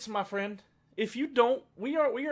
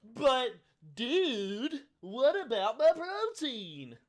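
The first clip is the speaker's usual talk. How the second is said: higher, slow, exaggerated plea; hammed-up actor; 6 semitones higher, 4 dB louder, much slower than usual. Mocking a paid actor asking about protein, hammed-up plea. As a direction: ham up a fake question. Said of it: "that dude's an actor".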